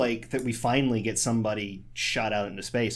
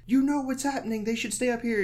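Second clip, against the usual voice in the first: high-pitched imitation of a panicked husband; retold freak-out; 11 semitones higher than usual. Husband pleading with ground control, mock freak-out. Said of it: "He's, like, freaking out".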